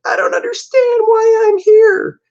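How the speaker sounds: high, loud, whimpering plea; crying falsetto. Acted. Interrogated woman pleading, crying in high falsetto. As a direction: wail it like a frightened suspect. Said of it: "She's like".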